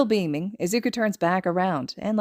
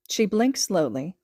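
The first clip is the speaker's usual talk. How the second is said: plain reading voice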